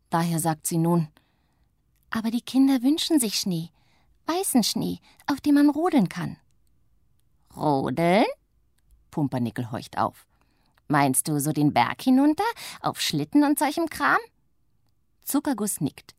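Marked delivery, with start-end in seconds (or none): 2.1-6.4 higher, coaxing witch voice; eager little witch. Acted. Little witch coaxes her sister, higher eager voice. 7.6-8.3 a touch of surprised interest; perked up. Acted. Grumpy witch perks up at sledding, curious question. 10.9-14.2 gruff witch. Acted. Witch asks about sledding.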